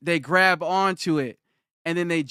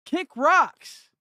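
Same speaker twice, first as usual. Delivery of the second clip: dismissive; 10 semitones higher than usual. Real, not acted. Dismissing the beggar.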